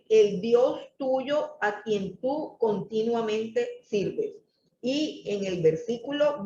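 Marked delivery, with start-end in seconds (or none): 0.1-4.4 slow, weighty reading of the key phrase; solemn emphasis. Shown as a demonstration. Preacher stressing the king's words to the congregation, slow and solemn. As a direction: lean on every word, slowly. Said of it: "Miren qué tremenda la frase".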